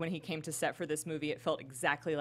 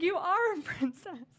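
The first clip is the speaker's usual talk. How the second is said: high, slow, drawn-out mock exclamation; mock princess gushing; 12 semitones higher, slower than usual. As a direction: act out princesses declaring their verdict. Acts out princesses welcoming Vanellope as one.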